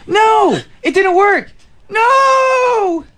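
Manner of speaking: despairing shout. Acted. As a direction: wail it at the sky. Time travel failed, despair.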